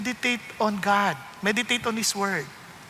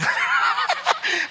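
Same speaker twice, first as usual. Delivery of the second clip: high laugh. Real, not acted. Preacher laughs mid-story.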